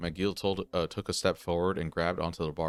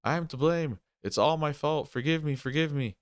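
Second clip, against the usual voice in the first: wailing; 8 semitones higher than usual. Acted. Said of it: "cowering and wailing".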